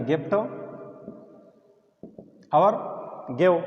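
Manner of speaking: گفٹ and گیو said with a hard g; hard g exceptions. Shown as a demonstration. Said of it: "مگر اس سے چند الفاظ مستثنی ہے".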